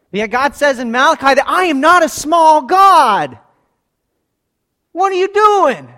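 shouted high sermon peak; full-force preaching. Real, not acted. Preacher thunders God's words at the congregation, shouting.

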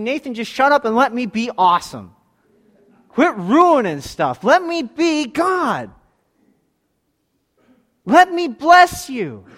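slow, high, loud voice of God; God speaking to the preacher. Acted. Preacher voices God telling him to stop and let him bless, slow and loud.

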